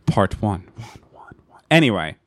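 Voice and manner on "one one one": whisper. Real, not acted. Whispered aside after part one.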